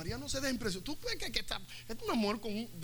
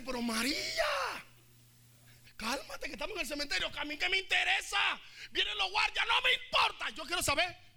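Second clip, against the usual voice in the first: heated argument; 7 semitones higher than usual. Acted. Susana and Mary Magdalene quarrelling, heated and high. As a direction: argue back and forth, getting hotter.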